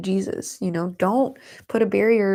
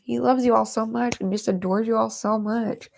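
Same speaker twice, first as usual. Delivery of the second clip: normal talking voice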